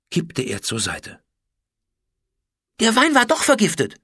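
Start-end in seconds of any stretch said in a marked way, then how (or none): 2.8-4.0 high, loud cry of alarm; alarmed scream. A narrator's reading. Kim screams that the dog's wine was poisoned, alarmed shout. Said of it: "schrie Kim".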